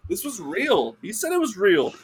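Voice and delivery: high-pitched earnest defence; insistent protest. Real, not acted. Defends the story as true.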